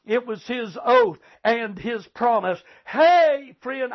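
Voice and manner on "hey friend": sudden raised exclamation; preacher's call. Real, not acted. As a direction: call out to rouse the congregation. Preacher calls out to congregation.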